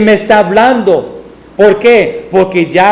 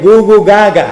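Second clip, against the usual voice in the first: put-on baby babble. Imitating newborn baby babble.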